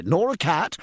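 keen midshipman earnestly explaining. A narrator's reading. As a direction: set him straight in all sincerity. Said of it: "they cried earnestly".

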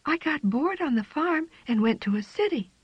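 put-on young cat voice. A narrator's reading. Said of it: "Jane explained".

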